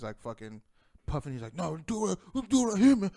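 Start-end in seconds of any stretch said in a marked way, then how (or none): 1.6-3.1 much higher and louder, a mocking challenge; imitating an aggressive shout. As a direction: puff up and bark it out like a hothead. Opponent puffed up, demanding fight.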